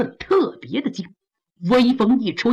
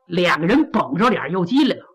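ordinary delivery

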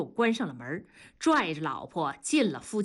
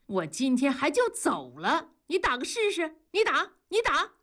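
high-pitched angry dare, climbing higher; flaring temper; 8 semitones higher than usual. A narrator's reading. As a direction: dare them, furious and rising. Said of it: "庄嫂噌的就火了". (火冒三丈地叫板)

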